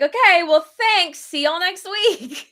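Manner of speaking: high, over-bright brush-off; awkward cheery goodbye. Retold or imitated. Pregnant customer fleeing an overshare at pickup, awkward cheery goodbye. Acting out her awkward exit.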